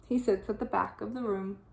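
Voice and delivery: plain reading voice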